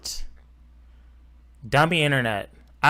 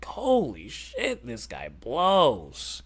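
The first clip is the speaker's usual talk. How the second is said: slow exasperated drag; much slower than usual. Real, not acted. Exasperated drawn-out groan.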